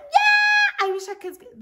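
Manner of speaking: high, loud yell that drops off; sarcastic shout. Retold or imitated. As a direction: shout it, then bite it off bitterly. Angry girl shouts before storming off.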